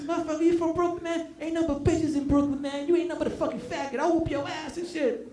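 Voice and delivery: hammed-up adolescent threat; blustering teenage boy. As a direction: act a kid talking big, fast. Acted teenage boy threatening him.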